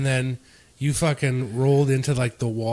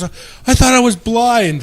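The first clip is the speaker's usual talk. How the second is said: imitated angry yelling; 9 semitones higher, 6 dB louder than usual. Victim telling pranksters off after the scare, mock outraged yell. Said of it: "he's, like, yelling and swearing at us".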